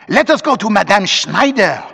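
eager mob cry; cried out. A narrator's reading. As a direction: call a suggestion out from the crowd. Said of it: "cried two or three".

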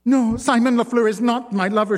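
high, loud, fast tearful protest; sobbing denial. A narrator's reading. Wife denies the affair to her husband, sobbing. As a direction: plead through tears. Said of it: "she sobbed".

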